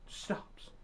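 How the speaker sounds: whispered "Stops."; a hushed dramatic beat. Pretend. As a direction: breathe it out in a hush, for suspense. Dramatic pause in bear story.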